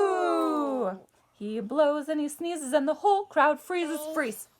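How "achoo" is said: big mock sneeze. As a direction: act out a bear's sneeze. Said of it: "can you guys sneeze with me?".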